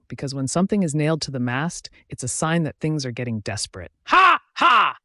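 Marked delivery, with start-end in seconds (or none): 4.0-5.0 two loud, high "ha"s; crazed stagey laugh, each "ha" separate; mad cackle. Acted. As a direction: burst out in a high mock laugh. Pip laughs out madly in monologue.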